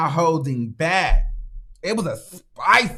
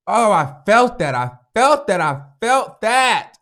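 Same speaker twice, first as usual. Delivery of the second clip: loud, high, FELT punched on each repeat; impassioned insistence; 7 semitones higher, 6 dB louder than usual. Real, not acted. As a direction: hit FELT harder each time, stretching the last THAT. Insists how deeply it moved her.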